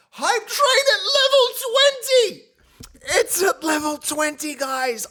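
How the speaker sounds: high, loud announcement; excited shout. Real, not acted. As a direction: shout it out proudly. Announcing level twenty.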